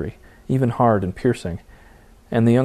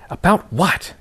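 hissed; 9 semitones higher, slower than usual. Acted. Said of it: "she hissed".

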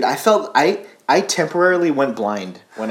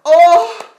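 excited shout; 9 dB louder than usual. Real, not acted. Reacting to the evil laugh.

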